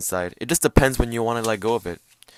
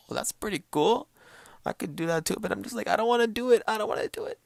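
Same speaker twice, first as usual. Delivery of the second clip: high-pitched reluctant whine; small, pitched-up mock thought voice; inner voice; 12 semitones higher, 3 dB quieter, faster than usual. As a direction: whine it like a kid refusing. Voicing own inner reluctant thoughts.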